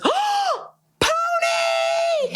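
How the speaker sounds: very high gleeful squeal of pony; delighted squeal. Retold or imitated. Friend spotting her in costume, high delighted squeal. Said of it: "turns around and goes".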